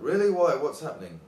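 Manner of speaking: eager, concerned follow-up questions; interested. Shown as a demonstration. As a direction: react as if you really want to know. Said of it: "be interested".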